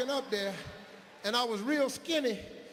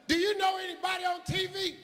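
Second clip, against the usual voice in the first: much higher, disapproving teacher voice, imitated; high mock teacher; 11 semitones higher than usual. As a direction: play the shocked teacher, pitched up high. Teacher voice in story.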